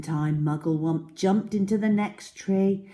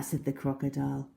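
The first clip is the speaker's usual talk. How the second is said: ordinary delivery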